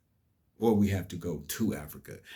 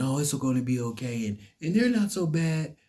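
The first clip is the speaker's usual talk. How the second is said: mock coddling tone; 7 semitones higher, 4 dB louder than usual. Mocking people who coddle each other.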